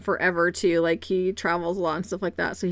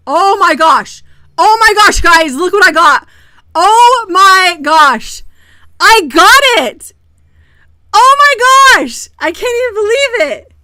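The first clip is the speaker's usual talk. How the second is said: very loud, high-pitched shrieks of joy; real elated yelling, drawn-out words; ecstatic screaming; 10 semitones higher, 16 dB louder, slower than usual. Real, not acted. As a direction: scream in stunned disbelief at a huge win. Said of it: "I am so excited right now".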